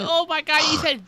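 high, loud imitation of scandalized kids; mock shock. Kids to their cursing teacher, loud mock shock. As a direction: gasp it like kids catching a swear.

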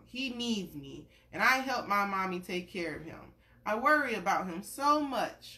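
gentle storybook read-aloud; reading to a child. Acted. Parent reading a picture book to a little one, gentle storytelling.

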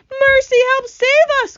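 loud, shrieking high plea; crying for help. A narrator's reading. Ladybug seeing the Cloudman climb down, crying for help. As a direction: scream for rescue in terror. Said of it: "cried the ladybug".

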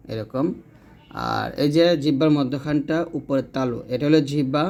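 usual speaking voice

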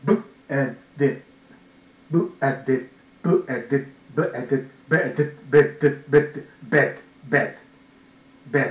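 ブッ、え、で in separate bits, gradually merging into English "bed"; sounds blending. Shown as a demonstration. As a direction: chain ブッ、え、で round and round until it becomes ベッド said as "bed". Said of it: "ていう風になるらしいんですよ".

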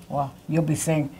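ordinary delivery

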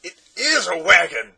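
loud, high excited bark of agreement; excited shout. Acted. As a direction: shout it out, thrilled. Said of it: "seconded Tock".

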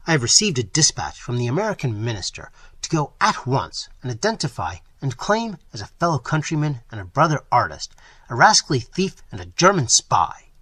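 loud, furious rant building; savage anger. Acted. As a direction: spit out the insult at the end. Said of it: "repeated Trent savagely".

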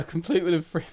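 ordinary talk

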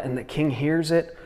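ordinary talk

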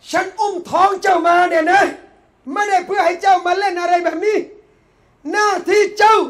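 loud, high, forceful scolding; stern mother's admonishment. Acted. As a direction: lay down a child's duty at full voice. Mother admonishes young Saladin.

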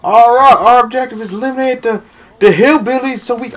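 brief the troops over the noise; mock commander briefing. Acts commander briefing over drums.

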